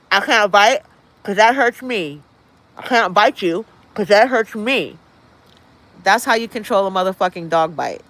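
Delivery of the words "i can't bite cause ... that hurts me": lightly voiced dog realizing it hurts; a dog talking itself out of biting; dog giving up. Voicing the dog that stops biting.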